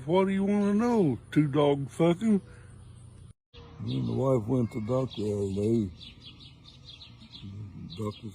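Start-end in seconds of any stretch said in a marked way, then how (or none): 0.1-2.4 put-on higher, exasperated snap at the kid; dad losing patience with the nosy son. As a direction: deliver the punchline as a fed-up dad.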